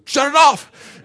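high, loud barked order; referee's yell. Retold or imitated. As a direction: shout it like a rattled referee. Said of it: "the referee goes".

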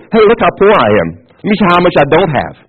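high, showy boast; playful braggart; mock bragging. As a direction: show off absurdly, voice lifted. Voices a poor man boasting.

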